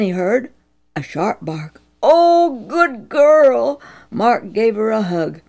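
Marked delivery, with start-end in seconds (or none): 2.0-3.8 relieved praise. Acted. Mark relieved the dog returned.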